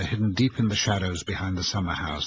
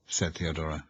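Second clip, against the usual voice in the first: ordinary delivery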